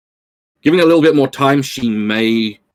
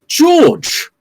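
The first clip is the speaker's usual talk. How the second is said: calling out; 4 dB louder than usual. Acted. Calling out a character.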